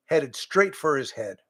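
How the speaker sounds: ordinary delivery, nothing marked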